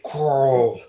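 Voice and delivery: "Carl" drawn out into one long, rounded sound; drawled TV-character name. Retold or imitated. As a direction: say "Carl" stretched, like the show's character. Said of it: "You, you gotta say it right".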